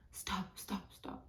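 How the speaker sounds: half-whispered, breath-only stop; retold plea, barely voiced; hushed urgent plea. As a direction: repeat it under your breath, urgently.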